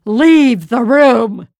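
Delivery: loud, high, slowed command to clear the room; the lord shouting at his servants to leave; urgent shout. A narrator's reading. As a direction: bark it out as tears come. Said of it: "He called to his servants".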